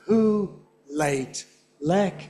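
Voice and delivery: slow, evenly spaced "who", "late", "lek"; one beat each for "who, late, lek"; stressed syllables on beats. Shown as a demonstration. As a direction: metronomic "who, late, lek" with clear gaps. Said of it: "on the beats".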